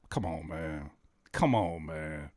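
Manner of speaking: low, soft come on man; deflated. Real, not acted. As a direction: sink into disappointment. Deflated after the Harvard line.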